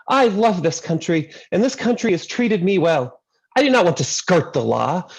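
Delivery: earnest patriotic speech in a hint of a Greek accent; Greek grandmother. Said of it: "My grandmother told him".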